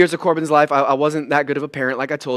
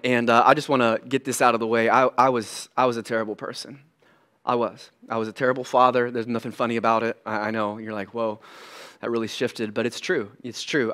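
low, hushed admission; soft, subdued, owning up to it; sober confession; 6 semitones lower, 9 dB quieter than usual. Real, not acted. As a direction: confess it quietly and regretfully. Sober confession of a painful past.

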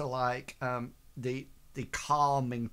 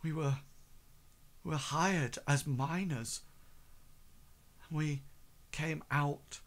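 a touch higher, halting, piecing memories together; halting recollection; 3 semitones higher than usual. Acted. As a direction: pause often as if recalling the order. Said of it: "trying to piece together what happened".

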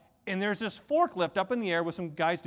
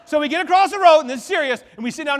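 excited; 7 semitones higher, 10 dB louder, faster than usual. Real, not acted. Excited story climax.

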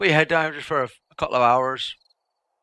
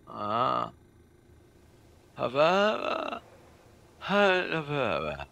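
mock bored viewer; 3 semitones higher, much slower than usual. Mocking chat viewers' indifference.

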